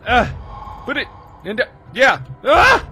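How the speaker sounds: high, loud frantic screams; shrieking panic while being chased; panicked screaming. Real, not acted. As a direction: scream in terror, fleeing.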